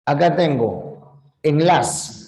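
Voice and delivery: ordinary talk, nothing marked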